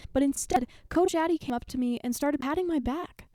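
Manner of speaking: plain reading voice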